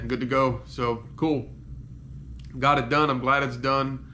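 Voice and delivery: ordinary talk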